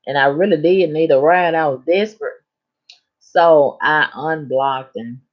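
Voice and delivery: ordinary delivery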